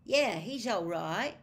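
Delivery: put-on a boy gruffly shrugging off the owl. A narrator's reading. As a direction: mumble a grudging yes, roughly. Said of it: "said Ron gruffly".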